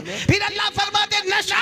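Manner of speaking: very high, held final word; ringing pulpit cry. Real, not acted. Preacher rousing congregation against intoxication, impassioned cry. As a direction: cry out and stretch the last word.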